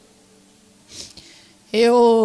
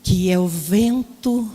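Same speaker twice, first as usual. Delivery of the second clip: reverent reading; 3 semitones lower, slower than usual. Retold or imitated. Reads meaning found reverently.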